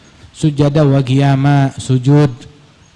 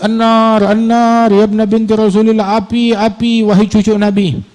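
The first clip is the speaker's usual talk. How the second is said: raised, higher cries of alarm; imitated screaming; 7 semitones higher than usual. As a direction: imitate a crowd calling out "fire". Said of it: "orang-orang pada ngejurit".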